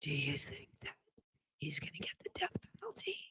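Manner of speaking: hushed, exaggerated whisper; very soft, breath-only stage whisper; playful whisper. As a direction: whisper it so a sleeping child won't wake. Trying to get my seven-year-old to sleep.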